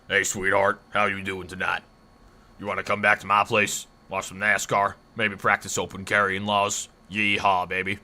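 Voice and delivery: put-on Texas accent. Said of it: "Their accents are awesome. Who, Texas?".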